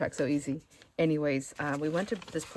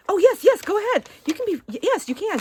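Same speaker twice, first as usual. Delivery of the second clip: excited mock go-ahead, pitched way up; eager encouragement; 9 dB louder than usual. Waitress leans in to encourage the diner, eagerly. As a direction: imitate a gushing waitress, high and loud. Retold waitress urges her on.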